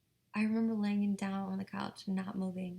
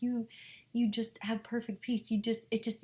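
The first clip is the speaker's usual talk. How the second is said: ordinary talk, nothing marked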